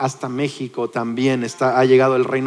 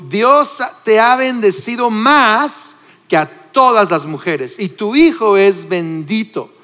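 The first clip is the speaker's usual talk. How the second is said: higher and louder, slowed, exclaiming with joy; joyful exclamation; 6 semitones higher, 4 dB louder, slower than usual. Acted. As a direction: cry out blessing with delight. Elizabeth's exclamation retold. (exclamación alegre, más aguda y fuerte)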